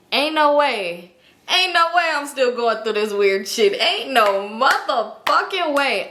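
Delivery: slow, drawn-out annoyed refusal; slow, fed-up repeat of the refusal; irritated disbelief. Retold or imitated. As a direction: stretch it out in disbelief. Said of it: "I was irritated".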